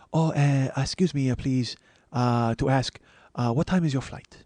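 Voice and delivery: put-on polite, hesitant Japanese-accented English; hushed, courteous Japanese accent; soft Japanese accent. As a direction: imitate a soft-spoken Japanese businessman, halting between phrases. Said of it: "gonna do that Japanese voice".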